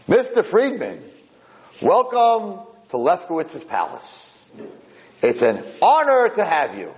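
high-pitched, grand welcome; happy-go-lucky host. Acted. Lefkowitz welcomes his new roommate, happy-go-lucky. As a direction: roll out the greeting with a flourish. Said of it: "a very happy-go-lucky guy".

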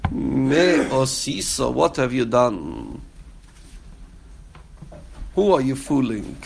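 slow, deliberate reproach; stern rebuke. Acted. God confronting lying Cain, slow and stern. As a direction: lay out each word like a judge who already knows.

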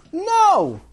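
very high, loud yelp; defiant refusal. Acted. Boy refusing his brother outright, high defiant shout.

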